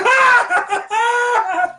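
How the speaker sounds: high, loud burst of mock laughter; one sudden hammed-up laughing shout; imitated crowd laughing. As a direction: roar like a whole crowd cracking up. Crowd roaring with laughter in joke.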